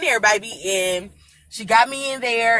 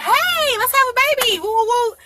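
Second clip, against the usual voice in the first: much higher, bubbly cheer; overly cheerful; 3 dB louder than usual. Retold or imitated. Woman in labor cheerfully greeting her doctor, giddy and far too happy. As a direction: greet the doctor like it's a party. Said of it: "You're too cheerful. You're too happy".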